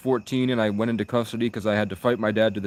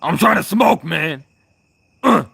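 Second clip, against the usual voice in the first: much higher, loud frustrated whine; loud plea; 8 semitones higher, 9 dB louder than usual. Real, not acted. Streamer complaining to chat he wants to smoke, loud whine. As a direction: beg loudly, fed up with waiting.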